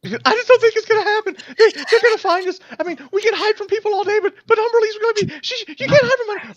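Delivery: very high, fast, breathless panic; panicking girl. Acted. Sammy frantic about Humberly finding them, very high panic. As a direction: spill the words out in terror.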